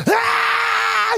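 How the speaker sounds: put-on sudden high shrieking child scream; a toddler's piercing wail; child's scream. Daughter waking in the dark, high shriek.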